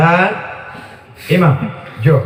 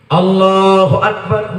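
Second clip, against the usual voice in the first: a plain, drawn-out takbir; imam's takbir; 2 semitones lower, slower than usual. Retold or imitated. Imam starting a quick tarawih, plain takbir. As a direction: open the prayer like a hurried imam. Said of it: "begitu cepatnya imam".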